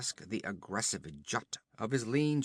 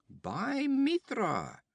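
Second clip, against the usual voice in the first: hoch und gedehnt ausgerufen, mit Staunen; fremde Stimme ruft; 13 semitones higher, 3 dB louder, much slower than usual. Acted. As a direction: ein Reiter staunt laut über den Gekreuzigten. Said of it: "Either a voice spoke".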